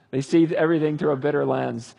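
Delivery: ordinary delivery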